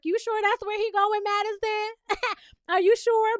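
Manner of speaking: high, mocking doubt with a laugh; suspicious tease. Real, not acted. Vlogger needling Madison about David's excuse, high mocking doubt.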